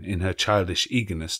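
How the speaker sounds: ordinary delivery, nothing marked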